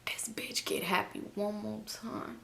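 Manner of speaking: slow whisper with deliberate pauses; hushed, measured exasperation; whispered warning. Retold or imitated. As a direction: whisper it slowly as a threat to yourself. Private threat, whispered.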